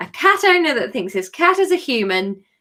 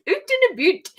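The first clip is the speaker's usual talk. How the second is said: mock Canadian; 10 semitones higher than usual. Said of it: "worst chapter of book club".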